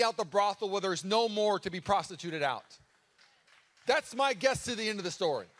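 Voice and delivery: loud, high passionate sermon; a pastor driving the point home loud; preaching at full force. Acted. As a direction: preach it out with fire.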